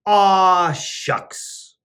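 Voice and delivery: ordinary delivery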